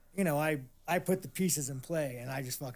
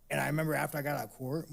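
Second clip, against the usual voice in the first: ordinary delivery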